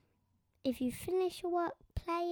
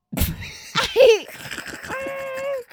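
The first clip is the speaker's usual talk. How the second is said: tiger roar laugh; 10 semitones higher, 12 dB louder than usual. Pretend. Child doing tiger impression.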